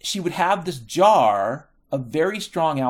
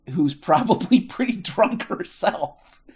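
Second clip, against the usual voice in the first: laughing; 4 semitones higher, 3 dB louder than usual. Real, not acted. Laughing while describing drunk bartender.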